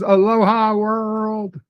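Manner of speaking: slow, high, sing-song Aloha world; drawn-out greeting. Retold or imitated. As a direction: stretch the greeting out like a lounge host. Reading a chat greeting aloud.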